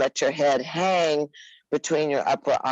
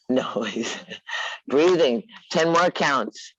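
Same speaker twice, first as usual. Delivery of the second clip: ordinary talk, nothing marked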